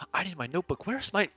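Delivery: building to a high, loud panic; frantic. Acted. Cole realizing he forgot his notebook, increasingly frantic. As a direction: escalate into flustered, exasperated despair. Scholar acting out missing notebook.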